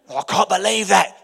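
loud, high indignant exclamation; indignant outburst. Retold or imitated. Husband hearing his wife was mistreated, loud indignant outburst. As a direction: blurt out your outrage. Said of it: "I have to pipe up".

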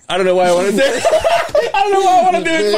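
high voice breaking into laughter; cracking up. Real, not acted. Teller baffled by his own impression, helplessly laughing.

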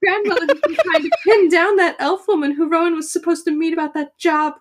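put-on high, grumbling old gnome woman; fast, muttering granny rant; little old grandma voice. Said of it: "She's like".

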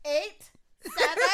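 put-on high, slow, drawn-out child counting with a laugh; son's slow countdown. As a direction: count it down slowly like a cheeky kid. Son counting down, hand raised.

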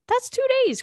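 high excited squeal. Real, not acted. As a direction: squeal it with delight. Excited aside in ad read.

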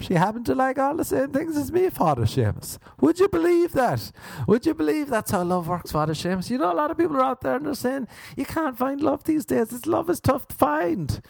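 high, gushing and fast; boastful boy. Retold or imitated. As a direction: brag to the priest with wide-eyed glee. Boy boasting to Father Seamus.

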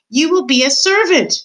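loud, insistent holler; shouted repeat. A narrator's reading. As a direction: shout each word so it gets through. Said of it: "shouted the soldier".

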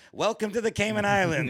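high, loud mock-official greeting; hammed-up big welcome announcement. Imagined officials greeting a man left waiting, mock hearty welcome.

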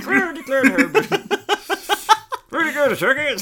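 high, giggling Fleur de Claire; laughing. Real, not acted. Host giggling at his own joke name, laughing.

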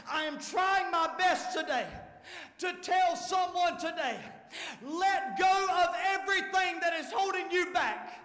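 rising altar-call fervor; preaching at full force. Acted. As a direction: plead with the congregation to let go.

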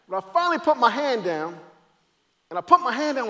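normal talking voice

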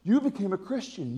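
usual speaking voice

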